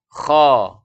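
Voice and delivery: KHO thick and loud, mouth slightly open. Shown as a demonstration. Tajwid teacher models the letter for learners, thick kho. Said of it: "mulut sedikit terbuka".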